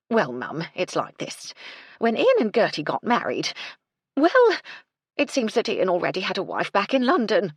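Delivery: housekeeper building to a loud, high burst on well; blurting out the scandal she dreads to tell; anxious cook. Acted. Mrs Chubb breaking bad news.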